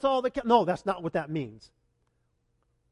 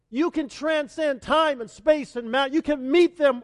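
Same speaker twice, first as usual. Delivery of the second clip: pleading prayer; 6 semitones higher, 7 dB louder than usual. Acted. Parent's acted anguished prayer.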